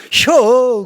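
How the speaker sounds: a high, loud Chungcheong "혀"; Chungcheong dialect. Retold or imitated. Preacher voices Chungcheong answer, loud dialect reply. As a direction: answer like a laconic Chungcheong local. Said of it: "충청도 사람들은".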